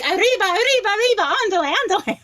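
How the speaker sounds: high, hammed-up cartoon Mexican accent, rattled off with a laugh at the end; mock Mexican accent. Son mocking friends who expect a Mexican accent, mock Mexican accent. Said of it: "they'll say he doesn't sound Mexican".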